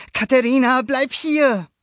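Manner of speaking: put-on high, loud boyish voice; boy calling out. A narrator's reading. Luca calls after his wandering goatfish, high and loud. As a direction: call the fish back as young Luca.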